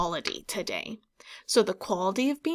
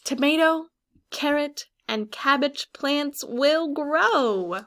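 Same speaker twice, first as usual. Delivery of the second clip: book line read out higher, louder and slow; story reading; 4 semitones higher, 5 dB louder, slower than usual. Acted. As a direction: announce the growing plants with wonder. Reading book text aloud.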